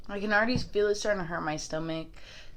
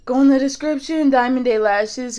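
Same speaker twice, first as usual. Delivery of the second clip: ordinary talk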